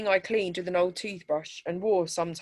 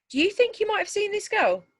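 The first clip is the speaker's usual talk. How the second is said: much higher, coaxing policewoman; 3 dB louder than usual. A narrator's reading. Policewoman showing children a missing girl's photo, higher and coaxing. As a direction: ask the child sweetly.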